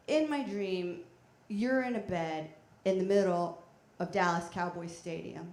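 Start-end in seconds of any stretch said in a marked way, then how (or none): none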